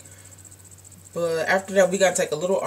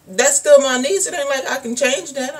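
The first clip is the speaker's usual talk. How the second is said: raised, firm aunt voice; aunt's defense; 7 semitones higher, 6 dB louder than usual. Retold or imitated. The aunt defending her niece, firm and raised. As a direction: stand up for your niece plainly. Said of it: "She was like".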